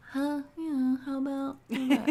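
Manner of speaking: high, hesitant pricing mumble; unsure. Shown as a demonstration. Showing a client how not to pitch, unsure. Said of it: "don't be like".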